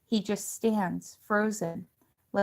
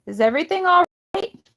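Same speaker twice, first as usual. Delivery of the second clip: high, fast worried call; 12 semitones higher, 10 dB louder, faster than usual. A narrator's reading. Missus Dickerson calls to the kids from the house, high fast worried call. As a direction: call the question from afar.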